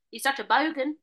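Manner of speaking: BOGAN going up at the end; Australian upward lilt on BOGAN; rising end. Shown as a demonstration. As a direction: make "bogan" sound like a question. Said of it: "They always go up at the end".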